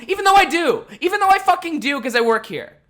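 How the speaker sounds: high, loud, indignant; furious insistence. Real, not acted. Reminding the customer he works there, furious insistence. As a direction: insist he knows, at full volume. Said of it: "But I was fucking angry".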